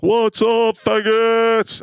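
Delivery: high, full-volume barracks yell; loud drawn-out greeting bellowed across a room. Retold or imitated. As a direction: yell at the top of your lungs. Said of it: "I would yell at the top of my lungs".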